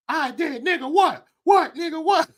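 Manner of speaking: high-pitched mock yelling challenge. Imitating the boy who went up to her, mock yell. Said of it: "he yelled at".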